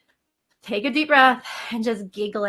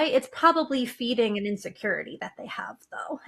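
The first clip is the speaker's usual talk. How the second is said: a hushed, breathy half-whisper; whispered aside; 5 dB quieter than usual. Real, not acted. Confiding to the host in a hushed whisper. Aside about people who mock falls.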